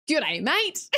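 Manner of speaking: a lightly hammed Australian "G'day, mate"; mock Australian accent. Answering chat's Australian question in mock Australian accent. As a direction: toss off a playful Aussie greeting. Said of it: "Whoa, are you Australian?".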